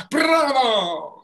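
triumphant shout bravo; cheering bravo. Shown as a demonstration. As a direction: cheer it to the rafters. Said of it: "Let's do it again".

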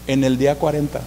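ordinary talk

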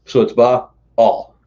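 Baal drawn out slowly as "bah-al"; Baal stretched into two slow syllables. Shown as a demonstration. Host repeating the scholar's two-syllable pronunciation slowly. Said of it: "two syllables".

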